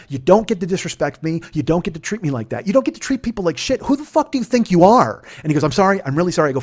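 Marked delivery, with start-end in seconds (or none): 0.1-5.2 higher voice climbing to a loud outburst; indignant scolding. Retold or imitated. Driver rebukes passengers for disrespect, indignant and building. 5.6-6.5 louder, raised mock pleading; urgent apology. Man apologizes to the angry driver, urgent pleading.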